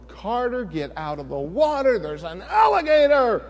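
loud, high call across the water; yelling to son. Retold or imitated. Father warning his son Carter about the alligator, yelling. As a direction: call out a warning to your boy. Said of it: "I yell over".

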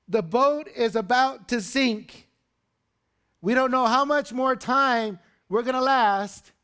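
high, loud cry of alarm; frantic high-pitched pleading, words stretched out; panicked disciples. Acted. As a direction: shout that disaster is moments away. Acting panicked disciples waking Jesus.

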